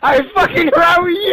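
high, loud outburst; angry yelling. Real, not acted. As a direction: yell it at a rival mid-quarrel. Mocking quarrel over stuttering.

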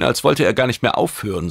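ordinary delivery

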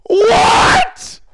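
high-pitched cry of shock; startled yell. Real, not acted. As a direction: yell in sudden disbelief. Reacting to a jump scare.

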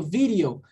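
"video" with the D as a light tap, "vi-rio"; subtle D. Shown as a demonstration. Teacher showing how subtle their D is, "video" with tapped D. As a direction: say "video" in English, barely touching the D. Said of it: "é um D bem sutil".

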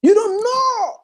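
high crying out; preacher's crying repeat. Acted. Repeated with a cry.

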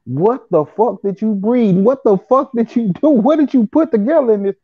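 freaked-out friend, voice going up and up; buddy in shock. Retold or imitated. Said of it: "my buddy was like".

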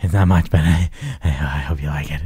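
low, timid mumble of a shy gift giver; Harvey voice. Pretend. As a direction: hand it over, apologetic and low. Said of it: "Uh-oh, it's Harvey".

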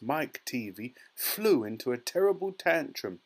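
usual speaking voice

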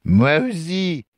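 high, drawn-out tipsy delight. Acted. Drunk stranger agrees he drank too much, delighted. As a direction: chime in happily, stretching the words. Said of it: "d'un ton réjoui".

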